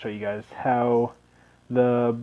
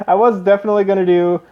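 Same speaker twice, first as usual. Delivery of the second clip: laughing, louder and higher; 7 semitones higher, 8 dB louder, faster than usual. Real, not acted. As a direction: laugh through the words. Laughing at his own bit.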